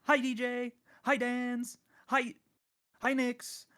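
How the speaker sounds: high, loud cartoon greetings; high-pitched rapid-fire roll call; SpongeBob. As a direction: chirp each hello like an excited cartoon character. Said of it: "I'm gonna pull a SpongeBob".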